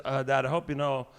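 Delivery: a hint of a whiny teenage girl; mock daughter asking dad. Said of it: "she says".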